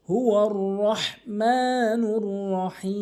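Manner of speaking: রহমানুর with a pressed ha from mid-throat; slow recited রহমানুর, heavy throat ha; throaty ha. Shown as a demonstration. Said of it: "হলকের মাঝখান থেকে চাপ দিয়ে".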